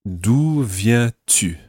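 "D'où viens-tu" very slow, each vowel drawn out; careful vowel sounds. Shown as a demonstration. Teacher corrects a learner's vowels, "D'où viens-tu" very slowly enunciated. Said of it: "just watch your vowel sounds there".

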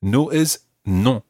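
slow, clear "No is non"; slow recap. Shown as a demonstration. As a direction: spell it out slowly. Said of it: "lesson recap".

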